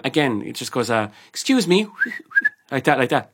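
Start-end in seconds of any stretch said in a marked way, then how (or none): 1.3-1.9 high-pitched, mock-polite summons; imitated highfalutin customer. Rude customer calling the barman over, imitated highfalutin voice. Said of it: "this was the way he talked". 1.9-2.5 short whistles to summon someone; imitated summoning whistle. Rude customer beckoning the barman, imitated whistling. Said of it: "Like waving his finger and whistling".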